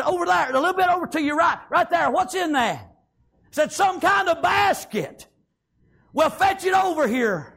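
lightly acted calling across the water; calling out directions. Pharaoh's daughter calls directions to maid.

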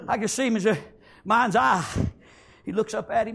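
normal talking voice

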